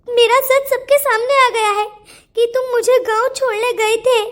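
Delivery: teary, choked telling; crying. Acted. Girl to the boy about being sent away, crying. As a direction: hold back sobs as you speak.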